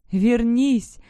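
loud, imploring cry; sobbed plea. A narrator's reading. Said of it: "всхлипнула я".

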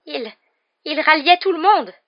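high, loud stammered excuse; panicked stammer. A narrator's reading. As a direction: trip on the first word, then protest. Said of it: "bredouilla Petit Gros". (bredouillement affolé)

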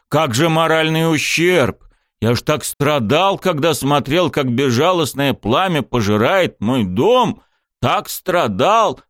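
slow, hammed-up whining lament; fake suffering. A narrator's reading. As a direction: fake tears over the burned house. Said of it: "как будто скрывая слёзы".

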